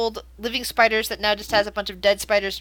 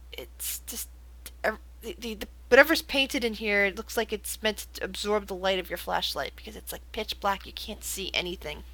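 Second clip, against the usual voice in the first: softer, dropping to near whisper; hushed scene setting; 7 dB quieter than usual. Acted. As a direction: draw them into the dark booth. GM sets a dark scene.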